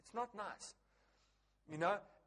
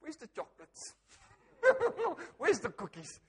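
asking for sweets between giggles; laughing craving; 7 semitones higher, 5 dB louder than usual. Retold or imitated. Husband asking his wife for chocolates, laughing. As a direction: beg for treats, amused at yourself.

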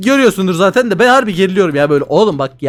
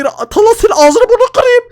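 very high, loud screaming rage; real screaming meltdown; 8 semitones higher, 5 dB louder than usual. Real, not acted. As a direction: lose it completely at Talha. Rage at Talha stealing again.

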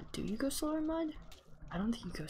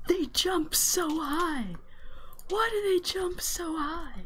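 much higher, louder, slow astonished cry; amazed outcry; 9 semitones higher, 5 dB louder, slower than usual. Real, not acted. Player crying out in amazement at how high the frogs jump. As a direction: marvel out loud, stretching it.

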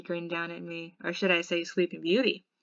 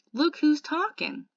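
slow, high and loud sarcastic jab; sarcastic retort; 9 semitones higher, 6 dB louder, slower than usual. Acted. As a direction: throw it back at him, mocking. Retorting thought line.

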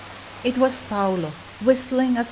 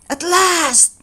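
higher, louder joyful cry; finally, food; relieved delight; 9 semitones higher, 4 dB louder than usual. Acted. Hungry cat tossed a fish at last, joyful cry.

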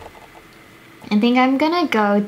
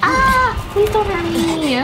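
high yelp into a whiny plea; squeal, then beg for mercy; high-pitched panicked begging; frightened pleading; 9 semitones higher, slower than usual. Real, not acted. Attacked by feared enemy.

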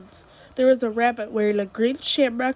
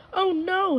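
a high, dismayed moan; 9 semitones higher than usual. A narrator's reading. Rabbit moans in dismay. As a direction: Rabbit moans on finding his pot gone. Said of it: "he moaned".

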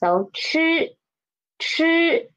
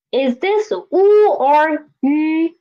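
normal talking voice

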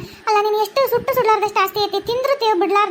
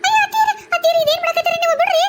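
alarmed shout; 10 semitones higher than usual. Acted. Daughter-in-law stopping a fight.

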